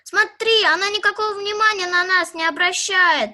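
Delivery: loud indignant call; loud indignant kid; loud complaint. Acted. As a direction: complain loudly to a friend. Complaining dog ignores them.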